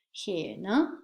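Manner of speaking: ordinary talk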